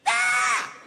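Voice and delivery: very high, loud mock battle scream; short scream of someone snapping; crazed scream. Passenger at the end of the bus snaps, crazed scream. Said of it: "he snapped. He lost his mind".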